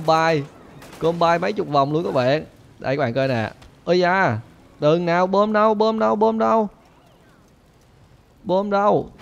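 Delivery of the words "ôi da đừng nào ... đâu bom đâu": high, insistent repeated calling; raised, eager chant-like repetition; excited urgency. Real, not acted. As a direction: pitch climbing as you hunt for it. Frantically hunting the bomb in game.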